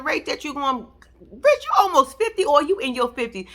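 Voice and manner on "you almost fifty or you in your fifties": normal talking voice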